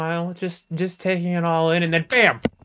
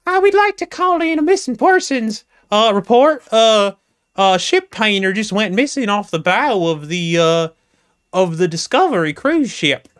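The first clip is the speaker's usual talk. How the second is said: much higher and louder, halting with lots of uhs; a nervous passenger stammering into the phone, pitched way up; flustered caller; 3 semitones higher, 5 dB louder than usual. Acted. Cruise passengers reporting the missing painter, high, loud and hesitant.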